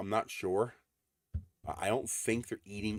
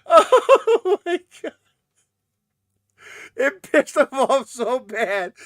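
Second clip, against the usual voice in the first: very slow, high and loud through laughter; telling the squirrel story, laughing loudly; 17 dB louder, much slower than usual. Real, not acted. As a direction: laugh the words out. Laughing while recalling story.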